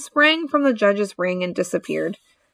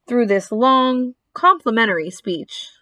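ordinary delivery

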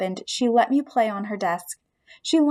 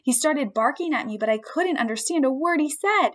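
plain reading voice

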